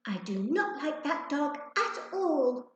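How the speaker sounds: slow, high, disdainful little voice; sniffy. A narrator's reading. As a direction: turn up her nose at the dog. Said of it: "sniffed Wysiwyg".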